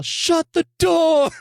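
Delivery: high, loud, stretched-out shout; audience yell. Retold or imitated. Heckler reacting to an open door, drawn-out yell. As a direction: yell it slowly at the screen. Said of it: "someone would yell".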